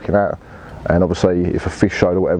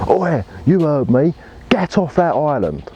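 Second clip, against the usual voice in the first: mocking the shouter; 7 semitones higher than usual. Angler mimicking the man who shouted him off, taunting. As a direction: ham up the smug bloke ordering him off. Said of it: "And then".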